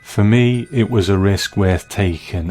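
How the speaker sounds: ordinary talk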